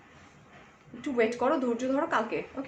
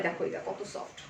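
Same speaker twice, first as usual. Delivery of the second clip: hushed admiring; 5 semitones lower, 9 dB quieter than usual. Real, not acted. Fondling the soft fabric.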